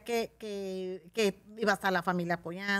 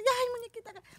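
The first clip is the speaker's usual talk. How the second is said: much higher, cooing fan voice; gushing fan; faster than usual. Retold or imitated. Fan greeting the doll character, high coo. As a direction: coo her stage name like a fan.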